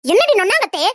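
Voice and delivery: loud mimicking retort; mocking echo. Mother-in-law mocking the daughter-in-law's lazy reply, loud. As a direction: throw her words back at her, sneering.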